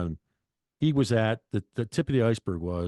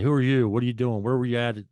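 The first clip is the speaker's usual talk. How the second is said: louder, higher police questioning; demanding cop; 5 semitones higher, 6 dB louder than usual. Retold or imitated. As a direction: fire the questions like a cop at a crime scene. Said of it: "the cops said".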